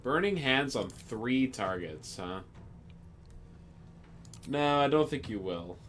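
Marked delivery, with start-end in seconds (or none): none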